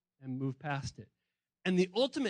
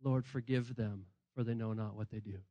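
Jesus on the cross; 9 semitones lower, 6 dB quieter than usual. Retold or imitated.

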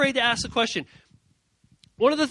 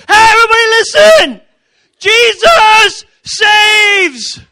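much higher, very loud shouting, stretched out slow; mock evangelist shout; 13 semitones higher, 17 dB louder, slower than usual. Shy kid preaching in the lunchroom, hammed-up shout. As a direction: yell it across the lunchroom like a street preacher. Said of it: "show up at the lunchroom and be like".

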